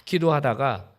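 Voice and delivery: ordinary talk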